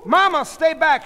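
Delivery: high, loud warning; urgent shout. A narrator's reading. Sheila fighting off her mother, urgent shout. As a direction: yell to hold someone back.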